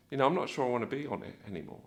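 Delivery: soft, quick, doubtful aside; thinking to himself. Acted. Nervous rider strapped in, thinking to himself. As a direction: voice a private second thought under your breath. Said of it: "start to think to yourself".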